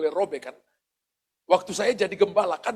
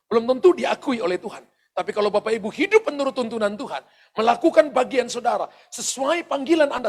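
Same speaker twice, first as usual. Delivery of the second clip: louder, rising preacher's push; berkhotbah berapi-api; 3 semitones higher, 5 dB louder than usual. Acted. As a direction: drive the exhortation home to the congregation. Preaching climax to congregation. (khotbah lantang penuh semangat)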